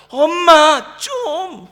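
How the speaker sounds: put-on loud, high whine of a fed-up kid; whiny child. Child whines back at a nagging mother, loud and high. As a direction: groan it like a teen sick of nagging. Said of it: "자녀들이 뭐라고 하는지 아세요".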